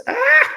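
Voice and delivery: sudden high-pitched yelp; startled high squeal; high short scream. Real, not acted. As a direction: let out a short, high little shriek. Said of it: "I didn't scream like a girl".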